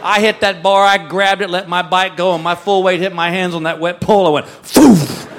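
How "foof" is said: sudden loud puff of a whoosh; imitated slipping whoosh. Storyteller showing hands slipping off the wet pole, loud whoosh. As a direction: burst out a loud slipping noise.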